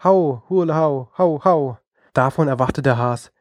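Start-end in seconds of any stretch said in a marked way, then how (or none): 0.0-1.8 sedikit takut, teriakan pelan; mild fearful cry. A narrator's reading. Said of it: "schrie er in großer Angst".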